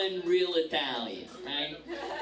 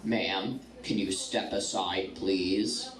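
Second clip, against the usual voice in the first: low, stern officer voice; a deep official request to step aside; TSA agent; 10 semitones lower, slower than usual. Retold or imitated. TSA agent pulling her aside, low official voice. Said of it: "TSA agent calls me over".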